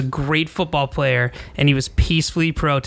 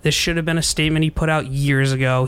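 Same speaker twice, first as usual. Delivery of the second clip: usual speaking voice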